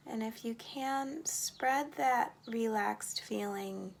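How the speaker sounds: slow, measured meditation voice with long pauses; unhurried breathing-exercise lead, words spaced out; guided meditation. Acted. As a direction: count the breaths evenly and guide them into calm.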